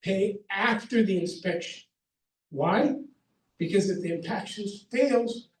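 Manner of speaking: ordinary talk, nothing marked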